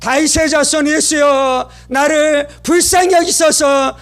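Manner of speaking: high, drawn-out plea for mercy; crying out. Retold or imitated. Blind Bartimaeus calls to Jesus for mercy, crying out. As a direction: call out slowly, pitch lifted, imploring. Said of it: "즉시로 소리 질러 이르되".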